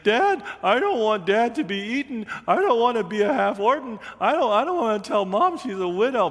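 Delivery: high, fast, half-played childish wail; a touch of hammed-up panic crying; mock wailing child. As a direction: whine it out like a scared little boy. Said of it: "[name] is wailing".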